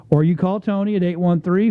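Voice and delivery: ordinary talk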